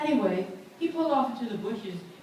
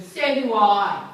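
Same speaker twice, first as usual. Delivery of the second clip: tough retort; 3 semitones higher, 10 dB louder, slower than usual. Retold or imitated. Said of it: "she whipped out a buck knife".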